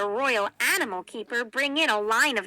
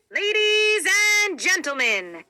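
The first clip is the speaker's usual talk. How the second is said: loud circus announcer call, pitched up high; booming welcome from the ring; 7 semitones higher, 6 dB louder than usual. A narrator's reading. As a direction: call it out to the whole tent like a ringmaster. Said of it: "he called".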